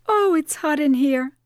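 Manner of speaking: high-pitched overheated complaint; exasperated. Acted. Woman suffering a hot flash, exasperated. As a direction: complain loudly of the heat.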